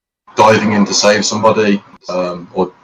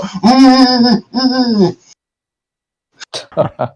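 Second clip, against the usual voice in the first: high mock moaning hums; much slower than usual. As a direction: hum like someone into bondage. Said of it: "people ... being like".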